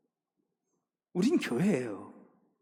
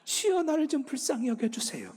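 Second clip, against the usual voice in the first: higher, beseeching plea for mercy; raised, imploring prayer; pleading cry; 10 semitones higher than usual. Retold or imitated. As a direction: beg like a tax collector beating his chest. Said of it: "가슴을 치면서, 땅을 치면서".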